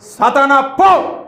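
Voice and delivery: high, slow stern command; a forceful binding prayer; commanding rebuke. Shown as a demonstration. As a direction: drive Satan off with authority. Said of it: "అప్పుడు ఎలా మాట్లాడాలి?".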